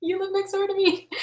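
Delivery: high, hushed, creepy little voice; furtive hush. Acted. Sidling up to the neighbor, high and hushed. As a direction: squeak it softly, secretively. Imagined sneaky approach to neighbor.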